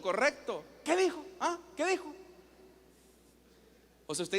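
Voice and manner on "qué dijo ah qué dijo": high, quick-fire challenge; confrontational. Shown as a demonstration. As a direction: pepper them as if wanting a fight.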